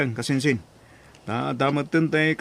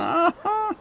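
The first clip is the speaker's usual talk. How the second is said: high laugh; 3 dB quieter than usual. Acted. Lender laughs at big amount.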